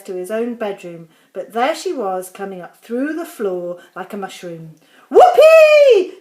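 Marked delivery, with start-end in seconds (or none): 5.1-6.2 put-on high, loud old woman's whoop; shouted whoopee. A narrator's reading. Grandma whoops as she rises through the floor, shouting. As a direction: let it burst out like a wild old grandma. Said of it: "she shouted".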